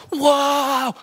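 high, loud exclamation of discovery; surprised whoa. Retold or imitated. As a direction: blurt it out, amazed. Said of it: "he's like".

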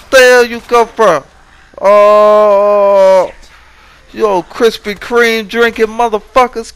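high, loud, frantic insults; panicked yelling. Real, not acted. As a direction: scream abuse at the swarming zombies. Zombies swarm, screaming insults.

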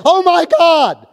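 high, loud and slow gasp of alarm; fearful exclamation. Pretend. Pastor showing what fear sounds like, alarmed cry. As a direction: blurt it out in fright. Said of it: "that is fear speaking".

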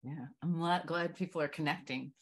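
ordinary talk

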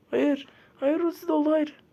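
high, loud cry of no; panicked shout. Real, not acted. Gamer shouting as the enemy's ultimate fills. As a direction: yell no in despair.

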